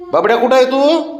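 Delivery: high sing-song seeking call; pet baby talk. Acted. To the hiding pet, playful high peekaboo calls. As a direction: coo as if searching for a hidden puppy. Playing hide and seek with pet.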